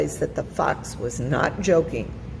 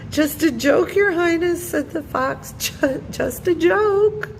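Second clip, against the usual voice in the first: high, stammering nervous excuse; nervous fox; 12 semitones higher than usual. A narrator's reading. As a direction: laugh it off guiltily. Fox caught by king.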